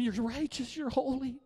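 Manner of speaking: high, pleading adoration; crying out to God. Acted. Preacher acting believers bowed before the Lord, pleading cry. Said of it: "we're gonna cry out to him".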